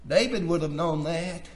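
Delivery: normal talking voice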